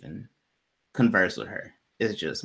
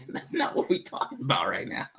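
laughing aside; 6 dB quieter than usual. Real, not acted.

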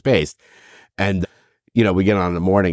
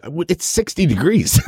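laughing disbelief; 8 semitones higher than usual. Retold or imitated. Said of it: "I'm like".

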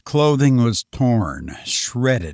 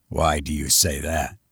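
gruff commissioner; 8 semitones lower, 5 dB quieter than usual. Acted. Commissioner's low voice.

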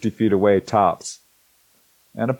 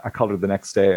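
ordinary talk

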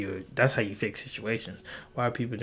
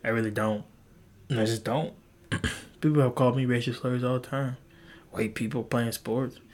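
usual speaking voice

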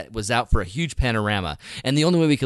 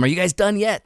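high-pitched call to the crew; retold impatient call, pitched up; 7 semitones higher than usual. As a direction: call over to them from afar. Calling to distant crew from cliff.

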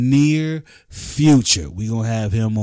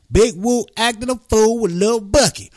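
higher, bigger show-title call; bigger hype announcement; 9 semitones higher than usual. Acted. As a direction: really sell the show name.

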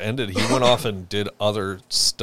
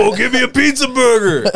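Carl voice; 9 dB louder than usual. Retold or imitated. Imitates Carl from Aqua Teen.

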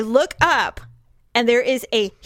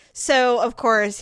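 ordinary delivery

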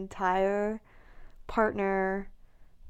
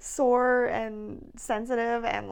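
slow drawn-out list; 3 semitones higher, slower than usual. Real, not acted. Listing her pregnancy moods.

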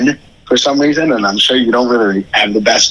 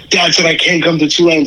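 put-on whiny kid brother; 7 semitones higher than usual. Imitating younger brother on phone.

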